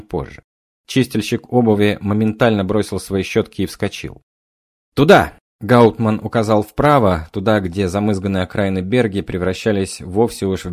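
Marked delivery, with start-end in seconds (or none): 4.9-5.3 urgent shout. A narrator's reading. Pointing during chase.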